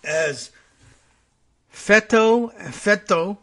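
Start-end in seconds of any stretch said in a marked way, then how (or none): 1.7-3.4 FETO sounded out "FET-oh", loud and raised; loud, higher, deliberate "FET-oh"; spelled-out pronunciation. Shown as a demonstration. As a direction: punch out FETO as two clear syllables, ending in OH. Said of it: "it's spelled out in English characters".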